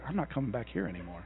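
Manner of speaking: low, fast grumble of a fed-up listener; imitating congregation. Congregant vowing never to come back, fast low grumble. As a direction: mutter it like someone vowing never to return. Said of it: "Everybody's like".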